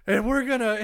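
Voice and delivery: high, loud Flair-style promo shout; Flair yell. Retold or imitated. Retelling Flair's promo to his co-host, shouting. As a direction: belt it out like Ric Flair on the mic. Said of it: "he does the".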